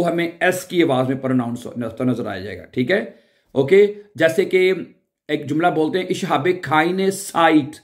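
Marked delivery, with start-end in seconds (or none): 6.1-7.7 साइट said with a ts sound, not z; slow "ich habe keine Zeit"; German Zeit. Shown as a demonstration. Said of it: "उसको जाइट नहीं बोलते". ("साइट" में ज़ की जगह त्स)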